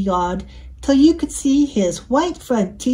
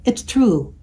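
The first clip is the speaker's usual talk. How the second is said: ordinary delivery, nothing marked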